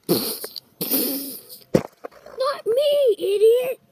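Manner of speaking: high, loud angry yell; furious shout. Acted. Slapped character shouting at the idiot actor. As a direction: yell it at him.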